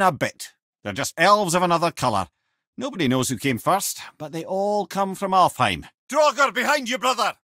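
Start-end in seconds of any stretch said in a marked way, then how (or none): 6.1-7.3 high, urgent call; combat warning call. Acted. Head warns his brother of a draugr, high urgent call. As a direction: snap the warning out mid-fight.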